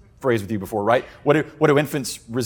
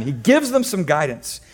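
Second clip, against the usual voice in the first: usual speaking voice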